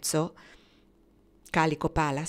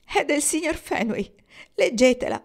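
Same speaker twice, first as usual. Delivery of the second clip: higher, tearful, voice catching; voice broken from crying; 9 semitones higher, 7 dB louder than usual. A narrator's reading. Rosabel showing Kendra the letter, tearful broken voice. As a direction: speak through the last of her tears. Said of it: "la voce ancora un po' rotta dal pianto".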